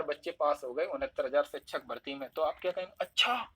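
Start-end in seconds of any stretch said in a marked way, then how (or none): 3.0-3.5 high, rising, astonished अच्छा; surprised अच्छा. Shown as a demonstration. Friend phones with a startling exam result, high surprised reaction. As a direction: jump up in pitch as if amazed. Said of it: "आपको आश्चर्य होता है".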